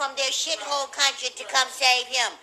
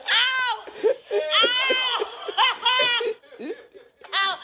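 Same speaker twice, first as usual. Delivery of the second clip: higher, yelping "Ow!" in glee; whooping ow; 6 semitones higher, much slower than usual. Real, not acted. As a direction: yelp with delight. Whoops while laughing.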